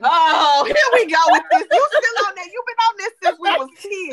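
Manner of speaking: loud, high, fast exasperation; exasperated. Real, not acted. Sister groaning at her sibling's old food rule, loud and high. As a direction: throw up your hands at her again.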